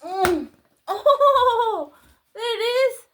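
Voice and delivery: high, loud laughing oh; high-pitched thrilled surprise; delighted discovery. Real, not acted. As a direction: burst out in giddy delight. Delighted when the top lights up.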